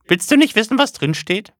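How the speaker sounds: high, eager prying mother; high prying mother's voice; nosy mother. Acted. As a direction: coax him to open it. Mother pries curiously.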